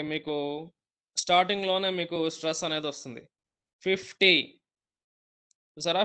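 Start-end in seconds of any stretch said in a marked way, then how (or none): 3.8-4.6 fifty with fif hit loud; stress on FIF. Shown as a demonstration. Teacher showing students the stress on fif. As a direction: punch the first syllable of fifty. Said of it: "గట్టిగా ఒత్తి పలుకుతున్న ఫిఫ్ అని".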